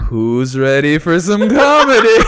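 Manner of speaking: slow, loud emcee call with a laugh; mock-cheerful announcer at midnight; host hype. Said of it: "And I go".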